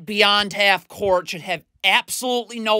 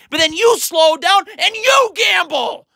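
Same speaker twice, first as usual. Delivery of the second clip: frustrated shout; 7 semitones higher, 4 dB louder, slower than usual. Real, not acted. Coach yelling at Caleb Martin.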